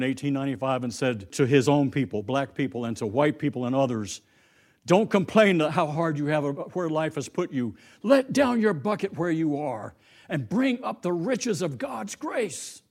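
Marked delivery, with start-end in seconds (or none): 4.8-12.8 louder, higher rising oratory, slowing at the end; preaching. Acted. Washington preaches his speech at Atlanta, full oratory. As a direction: proclaim it to the whole crowd.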